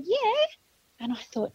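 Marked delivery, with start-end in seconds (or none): 0.0-0.5 tiny high-pitched far-off answer; squeaky little reply. Retold or imitated. Missing girl answering from afar, squeaky little call. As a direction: imitate a small child's faint squeak. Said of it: "it was such a squeaky little sound".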